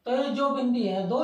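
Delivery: normal talking voice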